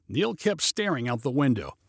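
usual speaking voice

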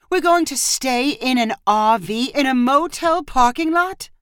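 high question climbing steeply at the end; incredulous cry. A narrator's reading. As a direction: repeat the plan in disbelief, pitch soaring. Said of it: "Marissa cried".

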